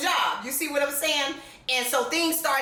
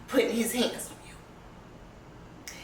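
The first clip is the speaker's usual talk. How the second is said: choked up; 7 dB quieter, much slower than usual. Real, not acted. Emotional confession, near tears.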